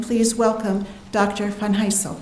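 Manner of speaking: usual speaking voice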